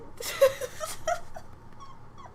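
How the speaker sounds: rising, squeaky laugh; soft high laugh-cry. Real, not acted. Collapse into laughter.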